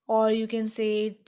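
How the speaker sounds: ordinary talk, nothing marked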